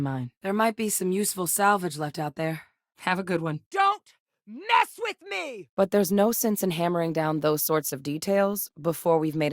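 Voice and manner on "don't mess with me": high, drawn-out angry yell; high-pitched battle cry; combat yell. Acted. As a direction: shout it as a threat, voice way up. Fighting outburst in game.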